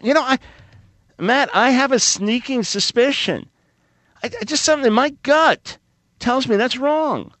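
high mock-innocent sarcasm; sarcastic. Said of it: "I am being sarcastic".

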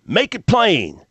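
high shouted call from the pews; retold yell. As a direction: call it out like a churchgoer. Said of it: "yells out from the congregation".

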